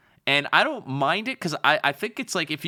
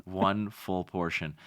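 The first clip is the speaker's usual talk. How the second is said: low, soft and slow repeat of the line; hammed-up crooner, drawn out; mock seductive; 6 semitones lower, 6 dB quieter, slower than usual. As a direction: savour each word like a cheesy lover. Repeats flirty pickup line.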